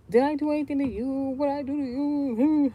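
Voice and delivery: high, loud, slowed mock of her whining; shrill mock whine. Mocking the elderly housemate's whine, high and loud.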